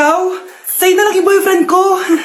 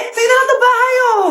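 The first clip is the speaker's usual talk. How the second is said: high-pitched, insistent sibling wail; acted bickering, pitched way up; squabbling whine; 6 semitones higher than usual. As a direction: push it away in a heated high voice. Siblings fight over inherited things.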